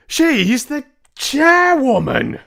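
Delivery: very high, very loud and slow, drawn out in disbelief; outraged cry. Acted. Mister Jenkins protesting about the chairwoman, shrill slow cry. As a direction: shriek in horrified protest. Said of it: "cried Mister Jenkins".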